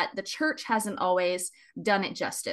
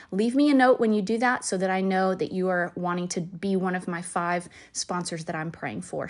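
ordinary delivery

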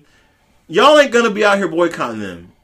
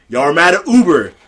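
ordinary delivery

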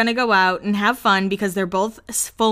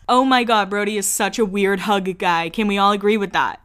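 usual speaking voice

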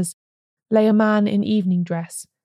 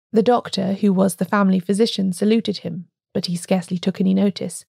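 ordinary delivery

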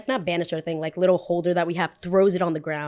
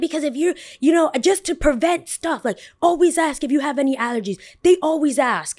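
high-pitched, worked-up customer imitation; mock indignant lecture; 6 semitones higher than usual. Customer lecturing barista on allergies, high mock indignation. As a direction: press the point like an irate customer.

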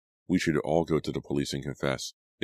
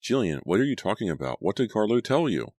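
higher-pitched, insistent questioning; demanding; 6 semitones higher than usual. A narrator's reading. Natalie questioning Jillian about Carlo's secret, demanding. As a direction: press urgently for an answer. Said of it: "I demanded".

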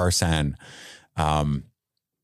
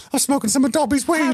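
very high, squeaky elf voice; Dobby impression. Dobby bit returns.

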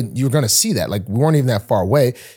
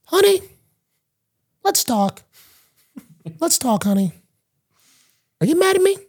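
mock sweet talk. Sweet-talking his upset girlfriend.

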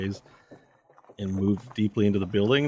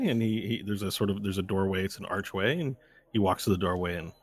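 ordinary delivery

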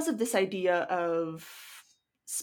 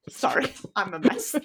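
laughing; 10 semitones higher than usual. Real, not acted. Laughing at her own mix-up.

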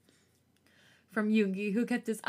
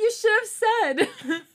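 laughing; 13 semitones higher, 9 dB louder, slower than usual. Acted. Teasing laugh at engagement news.

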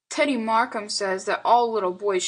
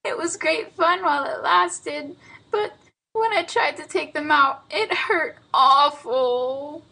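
put-on high little-boy wail, slowing at the end; sobbing child; 8 semitones higher than usual. A narrator's reading. Boy tells aunt how much the burrs hurt, sobbing. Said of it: "sobbed Lionel Hezekiah".

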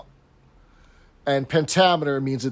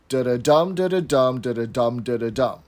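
"da-da-dum" two light beats then a stressed one; anapestic beat; 3 semitones lower than usual. Shown as a demonstration. Host demonstrates anapestic tetrameter, in a bouncing rhythmic chant. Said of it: "tetrameter means that there's four of them".

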